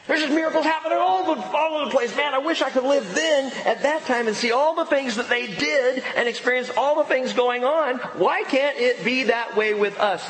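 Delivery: high, excited, gushing wishfulness; awestruck reader. Acted. Preacher voicing church members reading Acts, excited and high-pitched. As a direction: gush like a wide-eyed, envious fan. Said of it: "and we go".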